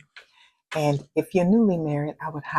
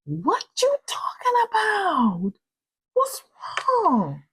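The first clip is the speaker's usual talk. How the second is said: higher, slower, pleading husband voice; bewildered husband; slower than usual. Retold or imitated. Husband baffled by his upset wife, higher and slow. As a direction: play him lost and alarmed. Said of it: "He was like".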